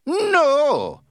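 loud, high-pitched outburst of refusal; angry. A narrator's reading. Captain refusing to sell his boat, angry. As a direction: explode on a drawn-out, angry word. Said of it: "the captain said angrily".

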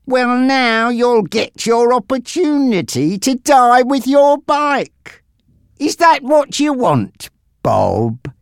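high-pitched, mounting threat; menacing gangster Frankie. Acted.

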